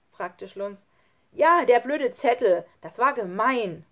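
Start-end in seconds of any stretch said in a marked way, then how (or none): 1.3-3.9 loud, slow, emphatic accusation; angry retort. A narrator's reading. Lukas blames Schlunz for the note, angry. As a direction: throw it back at him, offended.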